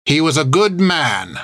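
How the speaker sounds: put-on old nun protesting to the pirate. A narrator's reading. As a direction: protest it like a loyal old sister. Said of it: "protested Hilda".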